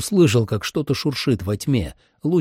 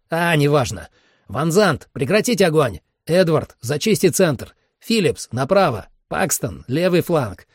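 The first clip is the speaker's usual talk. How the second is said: higher-pitched, urgent field orders; commanding squad leader; 6 semitones higher than usual. Acted. Commander directs his squad over radio, urgent command. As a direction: issue orders down the line, voice pitched up.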